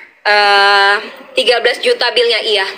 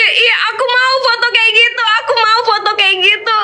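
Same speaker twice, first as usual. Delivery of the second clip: high, giggly mock begging; eager fan plea; 10 semitones higher than usual. Fans asking Aisar for the same photo, high eager plea. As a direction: mimic excited fans clamouring in a high voice.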